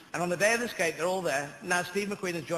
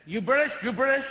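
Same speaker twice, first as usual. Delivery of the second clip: imitating an American man; 9 semitones higher, 6 dB louder than usual. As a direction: mimic a pushy Memphis dad, louder and higher. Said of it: "and he said".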